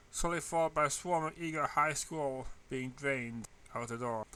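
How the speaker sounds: usual speaking voice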